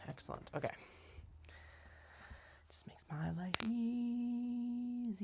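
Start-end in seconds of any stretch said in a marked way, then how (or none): 2.7-5.2 slow, breathy aside; slow whisper. Real, not acted. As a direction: murmur it slowly to yourself. Aside while working.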